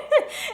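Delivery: high, loud laugh bursting out; burst of laughter. Real, not acted. Fan recapping the funny moment, loud laugh. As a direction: crack up at the scene.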